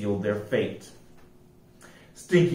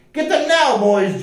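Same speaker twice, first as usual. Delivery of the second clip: loud, much higher attack cry; battle cry; 10 semitones higher, 4 dB louder than usual. A narrator's reading. Joe crying out to his men to attack, loud. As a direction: send them charging. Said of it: "Joe cried out".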